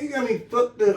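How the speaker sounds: ordinary delivery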